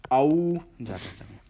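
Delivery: औ held long and slow; long vowel demo. Shown as a demonstration. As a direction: let औ ring out long. (औ को ज़्यादा समय देकर बोलना)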